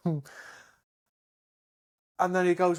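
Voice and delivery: ordinary talk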